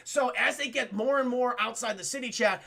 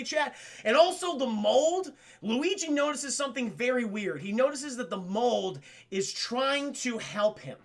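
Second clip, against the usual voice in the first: normal talking voice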